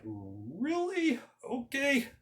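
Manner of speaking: high, mock-surprised king handing over armor; king sending a boy to fight; doubtful king. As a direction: humour the boy like a skeptical king. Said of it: "the king of Israel's like".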